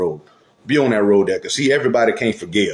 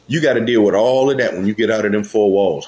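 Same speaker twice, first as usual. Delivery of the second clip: ordinary talk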